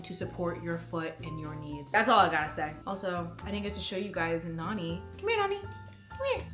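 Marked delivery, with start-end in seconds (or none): 5.2-6.5 high sing-song call to a pet. Real, not acted. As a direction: coo the dog over sweetly. Calling the dog.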